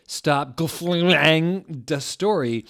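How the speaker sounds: drawn-out hammed-up scolding; mock angry author. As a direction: whine it out like a furious teen author. Reading the author's angry note.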